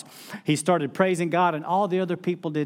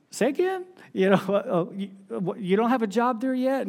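high, startled "say that again?"; stunned disbelief; 7 semitones higher than usual. Retold or imitated. Wife's parents hearing he has no job, stunned and incredulous. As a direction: retell the in-laws' shock with a high, incredulous voice. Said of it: "And they're like".

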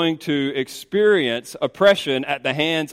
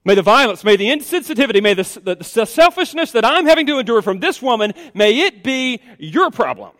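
higher, forceful, piling up grievances; indignant Sarai; 7 semitones higher, 5 dB louder than usual. Acted. Sarai blaming Abram for what she endures, indignant. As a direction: build an accusation in a raised voice. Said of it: "Sarai said to Abram".

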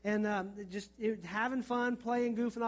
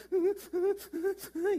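high hitching sob gasps; mock hiccuping sobs; imitated child's sob; 11 semitones higher than usual. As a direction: act out sobbing that catches the breath. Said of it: "that sob".

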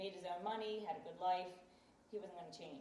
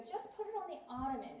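higher-pitched imitation of a dismissive husband; offhand brush-off; 6 semitones higher than usual. Husband putting off wife's book, dismissive. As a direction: wave the request away.

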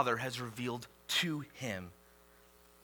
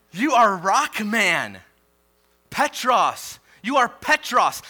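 much louder, slow, stretched "You are rock man"; preaching at full force; 5 semitones higher, 8 dB louder, slower than usual. Acted. Pastor voicing Jesus naming Peter, loud preaching. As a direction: proclaim the new name grandly.

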